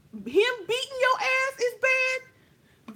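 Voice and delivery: high, slow mocking taunt; hammed-up sass. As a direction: rub it in her face. Threatening rival face to face.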